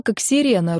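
plain reading voice

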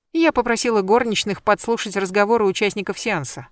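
ordinary delivery, nothing marked

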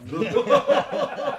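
a high, loud, strained laugh; nervous laugh. Acted. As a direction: burst out laughing at the accusation. Called mad, he laughs.

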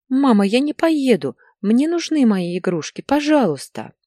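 put-on high, plaintive little-boy voice; pleading child. A narrator's reading. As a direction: beg your mother like a small child. Crying boy begs his mother. (жалобная детская мольба)

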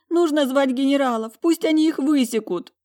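high, loud wailing demand; tearful outrage. A narrator's reading. Talia demanding the twins be whipped, wailing. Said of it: "снова заревела она".

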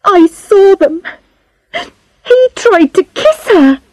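put-on high-pitched girl blurting out a confession; sudden tearful outburst. A narrator's reading. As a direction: blurt it out in a high, upset girl's voice. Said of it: "Then suddenly she said".

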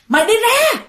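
high, loud furious yell; grandma yelling at the ghost to get out. Retold or imitated. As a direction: drive the ghost out of the house with a shout. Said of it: "vừa đánh mà vừa la".

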